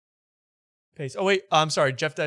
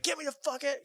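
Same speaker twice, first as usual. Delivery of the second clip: very high, softer mock plea; panicked plea; 14 semitones higher, 6 dB quieter than usual. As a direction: squeak it out high. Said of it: "You're like".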